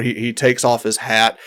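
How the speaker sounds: ordinary talk, nothing marked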